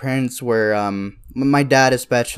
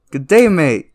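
louder, higher attempt at Aussie; bad Australian accent; 5 semitones higher, 6 dB louder than usual. Podcaster imagining an Australian life, badly faked Australian accent. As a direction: have a clumsy go at an Australian accent. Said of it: "I can't do an Australian accent".

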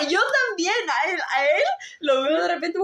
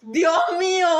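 higher louder cry of dismay; mock despair; 6 semitones higher, 4 dB louder, slower than usual. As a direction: wail it in comic despair. Caught boyfriend googling. (lamento exagerado y chillón)

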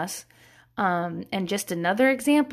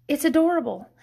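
ordinary talk, nothing marked

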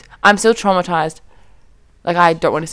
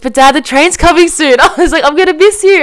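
higher, louder worried plea; retold panic; 6 semitones higher, 5 dB louder than usual. Daughter warns dad the train is coming, panicked. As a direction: rush of mock panic, voice climbing.

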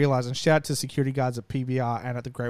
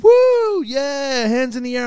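high, loud whooping crowd cheer; imitated over-the-top cheer; mock cheering; 11 semitones higher, 9 dB louder, much slower than usual. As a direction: whoop along like an excited spectator. Imitating man cheering at announcer.

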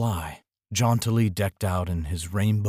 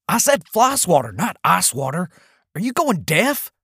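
put-on high, louder cranky old man; exasperated husband yelling; 9 semitones higher, 4 dB louder than usual. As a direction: shout it at a wife who won't listen. Husband yells at wife.

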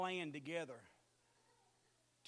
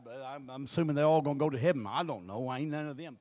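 preaching at full force; 6 semitones lower, 12 dB louder than usual. Real, not acted.

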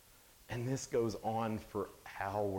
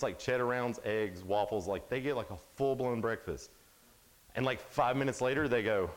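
normal talking voice

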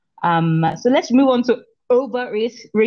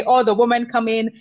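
ordinary talk

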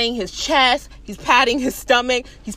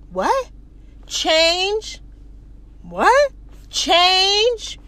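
higher, stretched puzzled questions; baffled; 5 semitones higher, much slower than usual. Retold or imitated. Man puzzled by guard asking for change, baffled. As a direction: act out not knowing the word.

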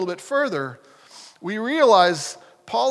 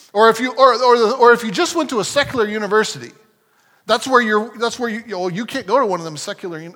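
lightly Southern, fast and louder; southern accent; 5 dB louder, faster than usual. Retold or imitated. Imitating preachers railing at secular universities, light Southern accent. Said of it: "why am I slipping into a southern accent".

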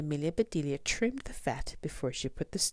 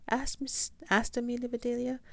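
usual speaking voice

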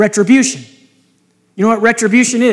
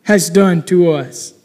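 trembling question; 2 semitones lower, slower than usual. Acted. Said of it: "turned trembling to one another".

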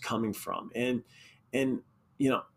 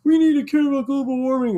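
very high, loud, fast preachy voice; high loud mock preaching; mocking hypocrites; 14 semitones higher, 8 dB louder, faster than usual. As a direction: parody a smug celebrity. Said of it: "It's like".

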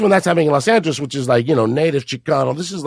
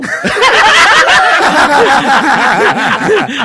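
loud laugh; 10 semitones higher, 7 dB louder than usual. Real, not acted. Laughing at his own story.

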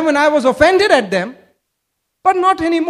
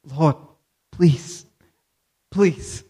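softer, pleading prayer; supplication; 8 semitones lower, 5 dB quieter, much slower than usual. Acted. Preacher voicing Paul's prayer to the Lord, pleading. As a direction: plead in prayer, pausing between the words. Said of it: "It's a supplication".

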